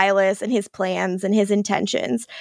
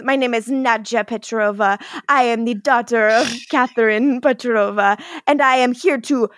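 put-on Nadja's accent. Podcaster voicing Nadja introducing herself, in a hammed-up Eastern European accent. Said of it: "you did that all with the accent".

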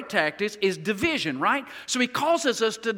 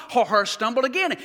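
ordinary delivery

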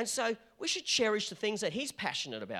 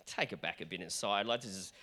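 lower, softer voice of a reserved listener; a hint of a hushed, disapproving member; mock quiet plea to tone it down; reserved churchgoer; 10 semitones lower, 4 dB quieter than usual. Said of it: "some of you looking at me now and going".

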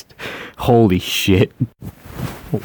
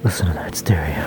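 ASMR whisper; 2 semitones lower than usual. Pretend. Whispering to ASMR listeners about stereo sound. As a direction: hush it close to the mic.

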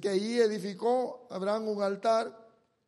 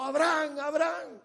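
higher, louder angel's shout; shouted call; 8 semitones higher, 6 dB louder than usual. Acted. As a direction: shout the name to stop him. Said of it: "le gritó".

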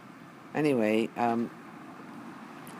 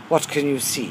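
light Welsh lilt on the question; imitating a Welsh man asking; Welsh accent; 5 semitones higher, 7 dB louder than usual. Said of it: "he said in a very Welsh voice".

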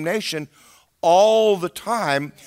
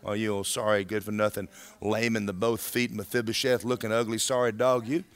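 muttered insults; 8 semitones lower, 7 dB quieter than usual. Acted. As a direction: grumble the abuse under your breath. Voices the devil's insults at believer.

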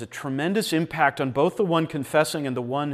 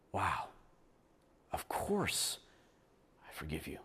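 moved forgiveness; 10 semitones lower, 17 dB quieter than usual. Retold or imitated.

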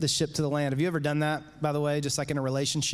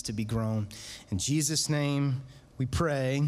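prayer. Acted. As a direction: pray gently to God for the church. Leads a prayer.

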